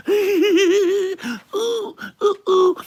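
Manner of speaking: very high squealing ooh; squealing cartoon ooh. Streamer squealing as an excited Disney adult.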